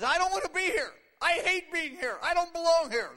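high, loud acted wail; hammed-up tearful protest; mock crying. Preacher acting David crying in Ziklag, loud mock wail. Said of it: "He's crying 'cause he says".

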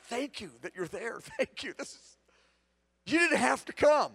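hushed grateful prayer; tender thanks. Shown as a demonstration. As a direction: speak it gently, like to someone close. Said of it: "I simply recognize he's there".

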